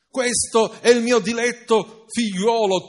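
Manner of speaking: a booming heavenly father; thundering voice from above. Acted. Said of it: "tuonò la voce dall'alto".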